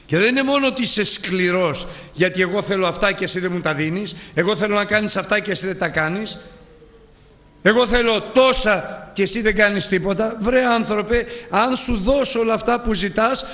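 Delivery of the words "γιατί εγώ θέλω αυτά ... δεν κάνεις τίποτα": mock whining. Mimics the whining servant.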